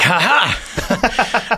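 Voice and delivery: a high, scoffing imitated laugh; mocking laugh. Mother-in-law scoffing at his UFO story, imitated laugh.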